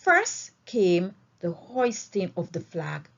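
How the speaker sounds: ordinary delivery, nothing marked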